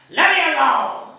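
loud high yell; shout. Acted. The citizen to Joe and the lad, loud yell. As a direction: bellow it at them. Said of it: "says he".

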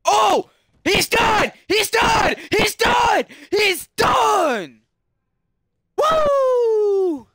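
frenzied high shouting, ending in a long whoop; Joe Rogan. Acted. Gamer celebrating a knockout as commentator, frenzied shouting. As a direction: scream the finish like a fight commentator. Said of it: "my Joe Rogan impression".

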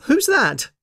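high little voice calling out; startled call. Acted. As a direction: call out sharply to an unseen visitor, pitched high. Character calls out on hearing visitor.

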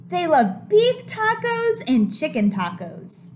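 lively picture book voice; reading to children. A narrator's reading. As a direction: read it big for the kids. Reads the book's opening line.

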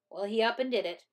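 ordinary delivery

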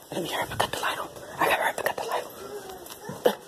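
hurried whisper aside. Real, not acted. Mom sneaking to turn the light on, whispering. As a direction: whisper it so she won't notice.

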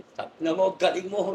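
high, lively retold cheer; half-performed happy yell; mock cheer recalling the win; retold shout. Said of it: "sumigaw ako malakas".

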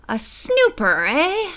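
sneering question with a high upturn on "eh". A narrator's reading. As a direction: sneer at a meddler. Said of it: "he sneered".

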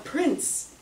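a mock exclamation, pitch jumping high; sudden high-pitched cry of his title; retold call-out. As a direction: call out the title in alarm, a little hammed. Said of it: "he lets out".

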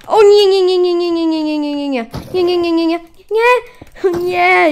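high, loud no, no, no, fading down; panicked no. Real, not acted. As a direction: gamer panicking as levitation hits. Knocked into levitation.

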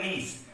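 नीस with a long drawn ee and a clear s; long ee in niece. Shown as a demonstration. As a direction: stretch the ee in नीस, end on s. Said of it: "इसको थोड़ा लंबा pronounce करेंगे". (नीस को लंबा खींचकर)

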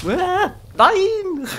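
high loud cry into laughter; shocked outcry. Real, not acted. Character dies, outcry then laugh.

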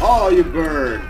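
loud, slow, drawn-out gloat; gloating shout. Real, not acted. Player gloating over a burning enemy, loud and slow. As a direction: relish it loudly as the enemy burns.

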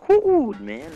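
high, loud hyped-up whoop; excited whoop. Real, not acted. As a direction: cheer it out like a fan. Hyped over basketball stars.

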